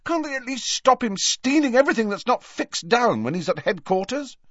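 high, strained angry rant; tense, outraged complaint; furious whisper. A narrator's reading. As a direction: rant indignantly in a squeezed voice. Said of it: "in a furious whisper".

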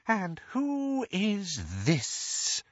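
high, slow, soft hissing voice; slow soft sinister hiss; soft snake's hiss. Acted. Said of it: "in his soft snake's hiss".

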